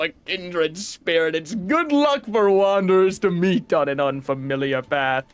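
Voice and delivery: put-on mild made-up European accent for the flea leader; a gentle foreign caravan leader, unhurried; fake European accent. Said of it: "that accent ... inspiration was somewhat European".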